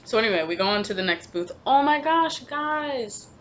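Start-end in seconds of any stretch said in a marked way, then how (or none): 1.7-3.3 high, startled "oh my gosh"; suddenly remembering something; sudden realization. Real, not acted. Suddenly remembers item in car.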